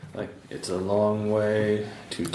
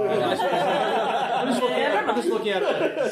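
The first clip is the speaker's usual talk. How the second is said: mock innocent; 13 semitones higher than usual. Cook innocently watches stabbing.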